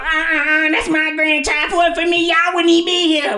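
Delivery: high loud scolding grandmother; grandma voice. Retold or imitated. Grandma scolds the dad for defending the boy, high loud voice. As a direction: put the dad in his place, high and loud. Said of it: "do her voice".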